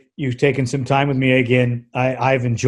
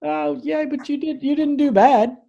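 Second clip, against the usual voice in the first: much higher, light and teasing; high reassurance; 13 semitones higher than usual. Real, not acted. Host teasing the guest he did well, much higher. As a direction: lift the voice to reassure him.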